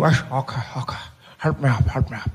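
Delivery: put-on laboured old man's voice; Nelson Mandela. Old leader asking driver for help up, laboured. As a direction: groan it while struggling to get up.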